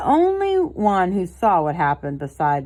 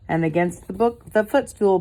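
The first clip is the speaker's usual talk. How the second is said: usual speaking voice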